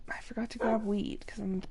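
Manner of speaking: unvoiced whispered aside. Real, not acted. Gamer whispering an aside to herself, hushed. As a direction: whisper it under your breath.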